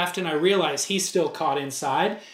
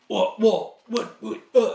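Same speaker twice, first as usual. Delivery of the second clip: stuttering; 3 semitones higher than usual. Retold or imitated. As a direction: caught off guard, stammering. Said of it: "kinda stuttered".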